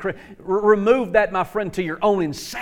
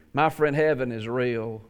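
ordinary delivery

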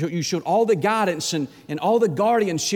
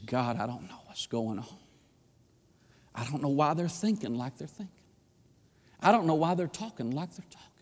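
heartfelt prayer. Acted. Preacher modelling a parent's prayer, hushed and pleading.

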